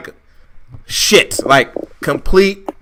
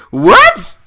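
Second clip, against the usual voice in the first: high, loud exasperated what; sudden yelp of disbelief; shocked shout; 5 dB louder than usual. Real, not acted. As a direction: blurt it out in disbelief. Sudden outburst after trailing off.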